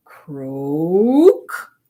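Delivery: very slow, low croak with a rising end; croaking frog. Acted. Dog mimics the croak frogs make when sat on, slow croak. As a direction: stretch out a big frog croak.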